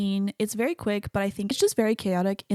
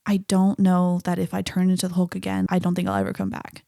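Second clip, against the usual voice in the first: normal talking voice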